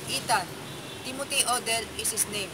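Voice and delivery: "Ethan", pause, then "Timothy Odell is his name"; pause after Ethan. Shown as a demonstration. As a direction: break the phrase right after "Ethan". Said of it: "Let's talk about phrasing".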